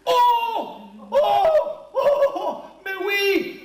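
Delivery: high, bursting cries of discovery; light up with a big idea; sudden idea. Acted. Marino gets an idea.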